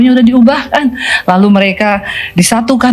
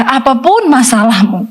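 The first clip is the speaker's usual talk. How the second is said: normal talking voice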